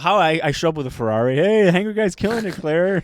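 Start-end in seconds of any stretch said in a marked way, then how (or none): 1.4-3.0 boastful. Retold or imitated. Boasting to Claire with Ferrari.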